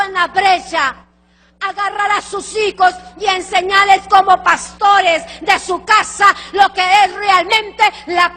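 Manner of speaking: loud, driving pulpit proclamation; full-force preaching. Acted. Preacher to the congregation, loud and fervent. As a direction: thunder it from the pulpit to the church.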